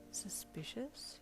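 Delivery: slow, soft, suspicious musing; inner thought. A narrator's reading. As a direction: think it to yourself, drawn out. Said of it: "you thought".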